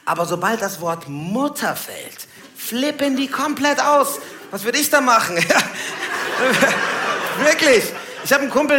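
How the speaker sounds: ordinary talk, nothing marked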